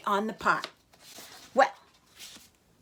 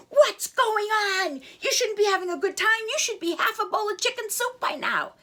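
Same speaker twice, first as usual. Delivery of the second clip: furious chef; 10 semitones higher than usual. Acted. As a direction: demand to know why the chick isn't cooked. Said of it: "he got furious".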